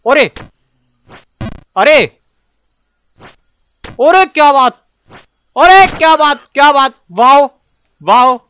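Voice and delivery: high, loud delighted shouts; real joyful whoop; ecstatic cheering. Real, not acted. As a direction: cheer it like you just won. Reaches a new area.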